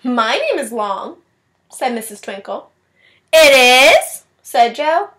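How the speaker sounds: put-on expressive storybook read-aloud for kids; reading to children. A narrator's reading. Teacher reading a picture book to her class, expressive read-aloud. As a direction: read it like story time, voicing each child.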